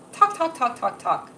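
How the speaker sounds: rapid repeated chatter starting high, dropping away; self-mocking yap. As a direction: babble the word like a yapping mouth. Mimics herself talking too much.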